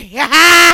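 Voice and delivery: big burst of laughter; loud laugh. Real, not acted.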